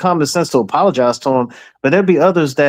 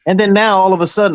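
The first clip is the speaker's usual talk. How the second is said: normal talking voice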